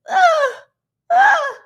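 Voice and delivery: loud, very high fake sob; short squealed mock sobs; mock crying wail. Recapper imitating housemate's meltdown, high mock sobbing.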